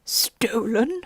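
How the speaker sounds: a lightly voiced king, hushed and whispered; king's whispered exclamation. The king exclaims to the jury, whispered. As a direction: gasp it out in a whisper like a startled king. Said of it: "the king exclaimed".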